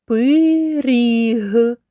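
"пиріг" with both vowels stretched; sounded-out word. Shown as a demonstration. Teacher breaking a word into sounds, drawn-out "пиріг". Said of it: "розібрати на звуки слово".

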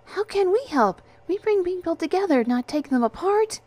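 put-on high, loud girlish-figure voice; high-voiced flustered objection; bewildered protest. A narrator's reading. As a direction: protest in puzzlement, pitched well up. Frightened angel questions the request.

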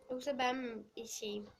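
ordinary talk, nothing marked

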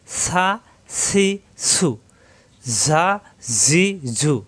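সা সি সু ঝা said with air hissing out; hissing letters. Shown as a demonstration. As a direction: let the air escape on সা, সি, সু, ঝা, জি, জু. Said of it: "বাতাস বের হবে".

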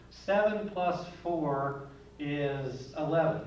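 put-on slow, careful, each number spelled out like a child doing sums; teacher acts out a child slowly working Seven plus four; slow counting out. Kid slowly working a sum.

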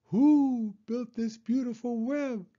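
high hooting owl. Acted. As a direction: ask it as an owl, hooting on who. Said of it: "asked the owl".

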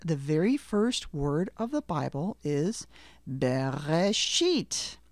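slow, careful explanation ending on Bereshit; slow teaching. Shown as a demonstration. Teacher slowly explaining the Bible's first word. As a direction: spell it out slowly for beginners. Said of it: "slow teaching pace".

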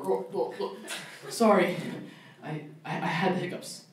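soft, half-whispered flustered apology; embarrassed date. Retold or imitated. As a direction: mumble it, caught hiccuping mid-dinner.

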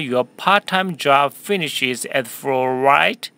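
slow, clear sentence for repeating; teacher modelling a question slowly for students; slow model reading. Shown as a demonstration. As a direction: read it slowly for the class to echo. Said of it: "한 번 더 할까요?".

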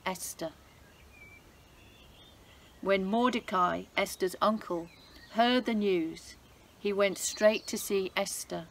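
lively, expressive storybook reading; story narration for children. A narrator's reading. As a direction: read it like a bedtime Bible story for kids. Reads Bible story to children.

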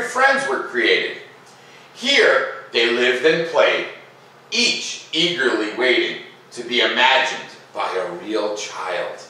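slow, lilting read-aloud voice; gentle storyteller pacing, each phrase drawn out; story narration. A narrator's reading. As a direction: read it like a bedtime story for kids. Reading the picture book aloud.

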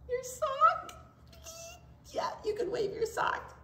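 teacher joking about waving a sock; silly squeak. Acted. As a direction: squeak it to make the kids laugh.

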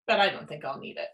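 plain reading voice

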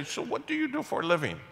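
mock-innocent high question. Pastor mimicking the man abruptly changing topics, high and mocking. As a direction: pitch it up, mimicking the guy's abrupt switch. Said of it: "and then you stop and say".

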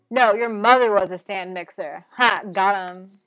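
loud gleeful taunt. Real, not acted. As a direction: taunt the robot loudly. Player insults robot.

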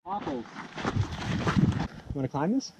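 normal talking voice

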